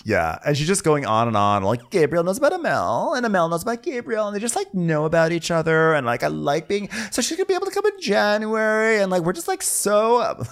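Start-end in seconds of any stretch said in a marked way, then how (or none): 1.9-10.4 put-on high, rushed valley-girl ramble; mock her endless yammering, pitched way up; high breathless gossip voice. Mocking a rambling castmate.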